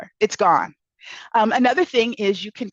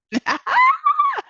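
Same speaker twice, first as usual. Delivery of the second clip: high burst of laughter. Real, not acted. Laughing at the quote.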